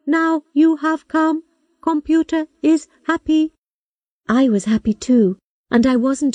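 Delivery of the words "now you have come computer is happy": high, slow, even machine voice; computer voice. A narrator's reading. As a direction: speak like a patient old robot. Robot computer speaks.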